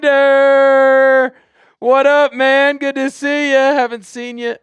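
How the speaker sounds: loud, high, thrilled shout-out to a regular; excited greeting. Real, not acted. Host greets a returning chat viewer.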